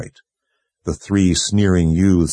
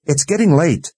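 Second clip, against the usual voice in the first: high-pitched, insistent protest; heated call; 9 semitones higher than usual. A narrator's reading. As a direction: argue heatedly through the door. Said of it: "Nicky called out heatedly".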